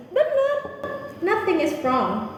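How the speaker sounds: emphatic confirmation. Acted. Teacher assuring a student that Singlish is correct, emphatic. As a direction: shoot the pitch up, loud and sure. Answers imagined student's doubt.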